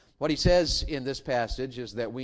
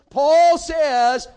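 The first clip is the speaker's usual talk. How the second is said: much louder, higher, calling out the setup; loud announcing shout; 12 semitones higher, 14 dB louder than usual. Real, not acted. Preacher setting up Paul's boast, a loud callout. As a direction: call it out to build suspense.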